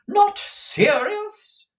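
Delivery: very high, loud, drawn-out question; affronted high-pitched disbelief; indignant echo. A narrator's reading. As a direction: echo it back incredulously. Mother indignantly echoes daughter's question.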